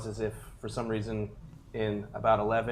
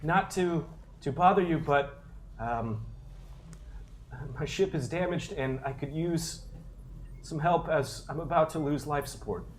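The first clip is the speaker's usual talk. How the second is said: higher, timid plea for help; nervous stranded pilot; 6 semitones higher than usual. Acted. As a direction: ask politely, a little anxious. GM voices NPC Kyle asking help.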